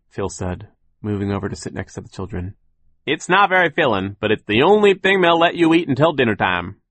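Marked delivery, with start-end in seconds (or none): 3.1-6.8 high, loud character voice; optimistic millworker. Acted. Worker tells children about gum lunch, high and loud. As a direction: pitch it well up and project, making the best of it.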